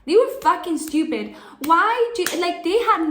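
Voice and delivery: loud fed-up burst; exasperated outburst. Real, not acted. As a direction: vent at them. Angry rant burst.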